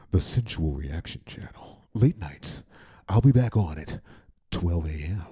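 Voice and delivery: low late-night radio host. Shown as a demonstration. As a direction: announce the station in a smooth DJ voice. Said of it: "my radio voice".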